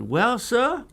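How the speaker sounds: a hint of Southern drawl, mimicking the officer; mirroring his accent. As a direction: copy the cop's Southern way. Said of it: "just like him".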